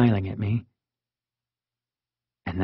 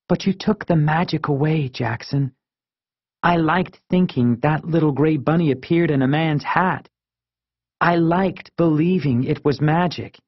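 higher-voiced, reproachful friend; Marisol; 5 semitones higher than usual. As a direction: plead for the lost magic, pitched up.

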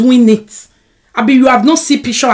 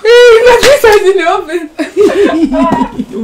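high, drawn-out cry through laughter; laughing outburst; 9 semitones higher, 4 dB louder, slower than usual. Real, not acted. Laughing about pizza in the oven, high and drawn out. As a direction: squeal it out, laughing.

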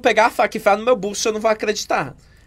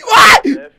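much higher, louder wailing cry; acted crying shout; 10 semitones higher, 10 dB louder than usual. Victim collapsing with a loud crying wail. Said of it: "você é muito boa atriz".

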